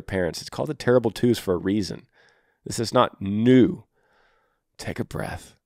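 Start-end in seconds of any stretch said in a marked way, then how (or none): 4.8-5.5 soft whisper, barely any voice. Shown as a demonstration. Coach whispers to a tired dad to calm down. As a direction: breathe the words out like a calming coach. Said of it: "coaching a frustrated dad to calm down".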